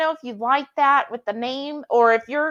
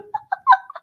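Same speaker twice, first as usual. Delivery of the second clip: laughing; 6 dB louder than usual. Real, not acted. Laughs at chat pun.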